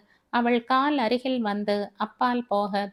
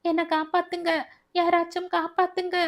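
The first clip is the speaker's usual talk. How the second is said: much higher, calling out for help; desperate cry for anyone to save her; 9 semitones higher, faster than usual. A narrator's reading. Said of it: "உரத்த குரலில்".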